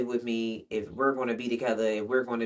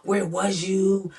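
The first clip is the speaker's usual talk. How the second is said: mock angry boyfriend; 8 semitones higher than usual. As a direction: imitate him demanding answers. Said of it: "unloading on me".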